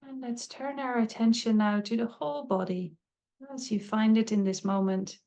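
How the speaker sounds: calm, unhurried meditation voice with long pauses; guided meditation. Acted. Teacher leading listeners into a body scan, calm meditative guiding. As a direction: guide them gently, leaving space between phrases.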